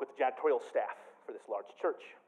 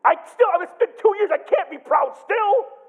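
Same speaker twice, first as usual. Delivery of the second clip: exasperated outburst; 9 dB louder than usual. Real, not acted. Exasperated pleading aside.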